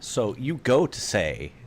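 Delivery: normal talking voice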